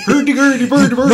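high, loud Swedish Chef babble; laughing fake Swedish nonsense; sing-song Swedish parody; mock Swedish gibberish. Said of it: "I can't do a Swedish accent".

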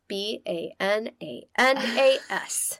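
B A N A N A S spelled letter by letter, slowly. Shown as a demonstration.

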